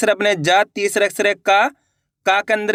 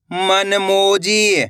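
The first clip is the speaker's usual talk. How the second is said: slow spelling; much slower than usual. Shown as a demonstration. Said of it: "अपन एक एक करके शब्दों को पढ़ेंगे".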